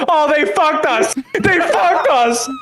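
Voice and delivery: high-pitched mock scream, drawn out at the end; imitated screaming. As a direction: imitate a friend screaming in outrage. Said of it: "he's screaming this".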